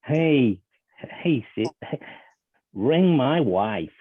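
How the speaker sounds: loud, high, drawn-out voice command; slow, insistent command. Acted. Caller to a confused voice assistant, slow and insistent. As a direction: spell it out slowly and loudly for a machine.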